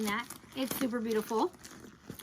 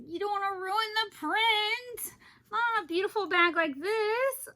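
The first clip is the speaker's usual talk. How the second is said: high, pleading whine; fussing dramatically, pitched up; mock distress; 8 semitones higher than usual. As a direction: lift it high in playful dismay. Mock distress over ruined print.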